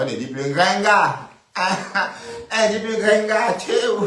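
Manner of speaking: voix qui monte très haut, forte, coupée de rires; moquerie hilare. Real, not acted. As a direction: railler en criant, mort de rire. Moqueuse, hilare, interpelle Grenga absente. (moquerie hilare, criée dans l'aigu)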